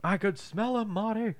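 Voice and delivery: a lightly voiced sinister line; villain voice. Quoting movie villain line.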